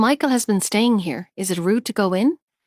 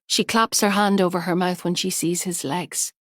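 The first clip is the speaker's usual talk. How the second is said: ordinary delivery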